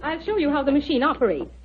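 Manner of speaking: ordinary delivery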